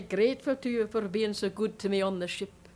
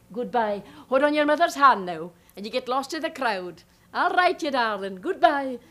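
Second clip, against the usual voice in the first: calling goodbye; 2 semitones higher, 6 dB louder than usual. Acted. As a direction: call out to the little girl as she goes. Calling after departing child.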